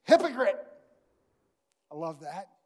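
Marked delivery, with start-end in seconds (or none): 0.0-0.7 loud, cutting call of hypocrite; Jesus calling out the hypocrite with force; sharp rebuke. Acted. Jesus calls out hypocrites, a loud sharp rebuke.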